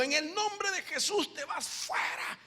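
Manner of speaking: high, forceful rebuke, drawn out at the end; commanding exorcism. Acted. Preacher acting out casting out a spirit, commanding exorcism.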